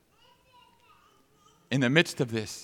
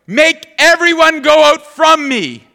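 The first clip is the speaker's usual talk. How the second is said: much higher and louder, slow and forceful; commanding cry; 17 dB louder, slower than usual. Acted. Joseph ordering everyone out before he breaks, loud slow cry. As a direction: cry out the order to clear the room. Scripture quote of Joseph crying out.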